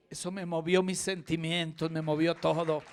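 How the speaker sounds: loud, rising preacher's cry to the crowd; preaching at full force. Real, not acted. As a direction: belt it out to stir the congregation. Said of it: "Dele ese aplauso al Rey".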